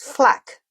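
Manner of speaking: 'flak' drawn out with the A of 'fat'; flat-A 'flak', rhyming with 'back'; short A. Shown as a demonstration. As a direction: say 'flak' slowly with an open 'a' as in 'fat'. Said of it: "it's A as in fat".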